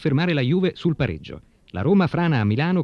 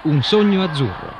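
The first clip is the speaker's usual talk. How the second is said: announcer voice; 4 semitones higher, 4 dB louder than usual. Acted. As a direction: boom out the show title. Program title announced loudly.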